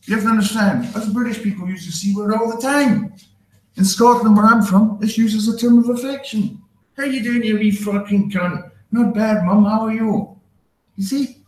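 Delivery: high-pitched, mock-earnest explanation to an offended stranger; apologetic Brit explaining. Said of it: "I'm like".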